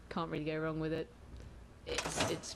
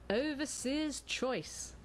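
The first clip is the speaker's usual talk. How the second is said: grand announcement; 6 semitones higher, much slower than usual. Acted.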